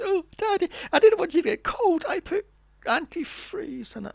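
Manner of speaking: put-on high, sweet little-kid voice; high child voice. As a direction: play an innocent child explaining. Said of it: "child voiced in retold scene".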